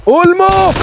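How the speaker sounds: sudden high loud shout of the name; excited call. Real, not acted. Streamer shouting his player's name, high and loud. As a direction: yell it out.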